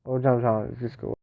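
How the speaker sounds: normal talking voice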